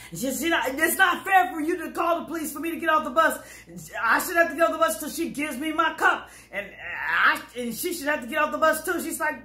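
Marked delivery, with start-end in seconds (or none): 0.7-9.0 high fast indignant shout; retold complaint. Woman arguing with the driver, mock indignant shout. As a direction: protest hotly.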